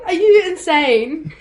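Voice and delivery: mock-stunned, stretch out the question; retold disbelief. Actor recalling her reaction to getting the role, incredulous.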